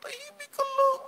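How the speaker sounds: very high, squeaky, held-in squeal; stifled joy. Pretend. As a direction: squeal with joy while trying to keep it down. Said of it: "gusto kong sumigaw".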